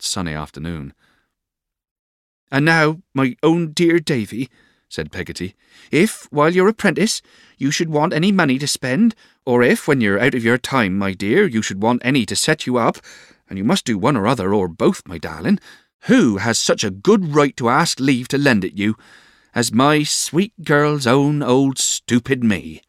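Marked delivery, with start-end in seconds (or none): 2.5-4.5 high, slow, fond address; motherly servant voice, pitched well up; doting old nurse. A narrator's reading. Old nurse Peggotty's fond farewell, acted. 5.9-22.8 high, emphatic, fussing offer; motherly servant voice, raised and insistent; doting old nurse. A narrator's reading. Old nurse Peggotty offers money, acted.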